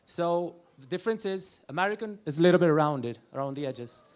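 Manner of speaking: usual speaking voice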